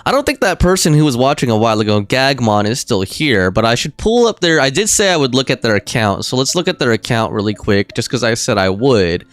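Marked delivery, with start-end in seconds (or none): none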